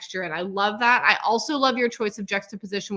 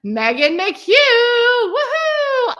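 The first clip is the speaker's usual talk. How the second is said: louder name, woohoo much higher; excited cheer; 12 semitones higher, 10 dB louder, slower than usual. Real, not acted. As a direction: whoop for the winner. Announcing graduate name.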